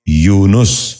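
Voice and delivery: یونس said as "yoo-nus", short u after n; correct pronunciation. Shown as a demonstration. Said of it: "درست تلفظ ہے".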